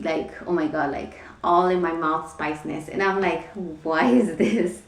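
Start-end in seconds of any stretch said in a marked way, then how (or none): 3.8-4.9 baffled question breaking into a laugh; laughing disbelief. Retold or imitated. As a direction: ask it through a laugh, amused at yourself. Mock bewilderment at own craving, laughing.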